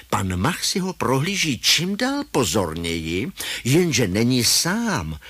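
ordinary delivery, nothing marked

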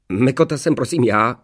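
aggrieved correction. A narrator's reading. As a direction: correct the master, sounding wronged. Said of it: "opravil ho ukřivděně ošklivý vousatý trpaslík". (ukřivděný trpaslík)

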